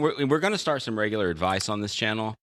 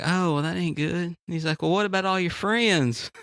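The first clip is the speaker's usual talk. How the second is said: passerby voice; 7 semitones higher than usual. Retold or imitated.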